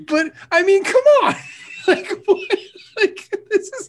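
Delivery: high squeaky laughing words; breaking up, barely getting words out; cracking up mid-line. Real, not acted. Laughing fit after joke.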